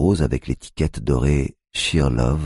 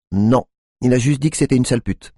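Tiffany's voice; 10 semitones higher, 4 dB louder than usual. A narrator's reading. Tiffany answers.

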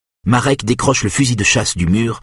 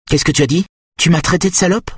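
indignation furieuse; 10 semitones higher, faster than usual. A narrator's reading. Mother challenges a whispered insult.

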